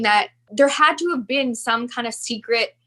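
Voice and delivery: normal talking voice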